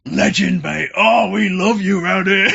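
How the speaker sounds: high, loud mock cheering; acted rowdy welcome. Inmates greeting the newcomer as a legend, rowdy cheer. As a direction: imitate inmates hailing a hero.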